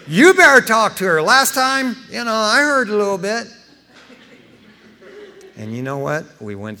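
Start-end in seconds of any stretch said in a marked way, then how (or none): 0.0-3.5 loud, higher joking plea to God; hammed-up, loud begging; mock plea.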